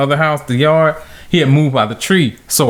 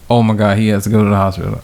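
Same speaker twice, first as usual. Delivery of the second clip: low, fast panicked rush; 7 semitones lower, much faster than usual. Retold or imitated. Scared kid thinking aloud about the hospital, low and fast. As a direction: gabble it out under your breath in fast panic. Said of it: "I was like".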